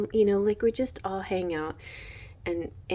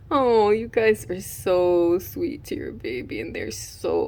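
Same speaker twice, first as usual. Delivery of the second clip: tearful. Real, not acted. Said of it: "I'm gonna cry again".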